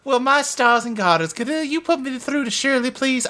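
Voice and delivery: put-on Southern Belle accent. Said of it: "like a Southern Belle accent".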